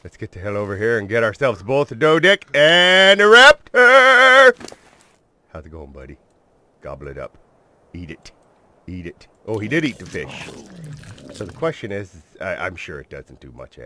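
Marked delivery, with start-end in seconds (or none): 0.0-4.5 hyped. Real, not acted. Rallying viewers. 5.5-9.3 soft coaxing. Real, not acted. Coaxing the dinosaur to eat.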